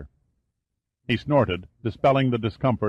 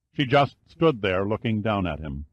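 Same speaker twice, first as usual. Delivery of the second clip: ordinary delivery, nothing marked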